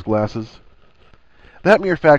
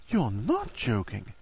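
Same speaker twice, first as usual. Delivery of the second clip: former depth regained; 9 dB quieter, slower than usual. A narrator's reading. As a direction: drop back down and say it slowly, softly. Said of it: "had reclaimed its former depth".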